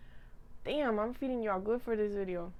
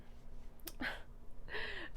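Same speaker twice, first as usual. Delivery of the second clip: breathy laugh; 10 semitones higher, 15 dB quieter than usual. Real, not acted. Laughs at her own rambling.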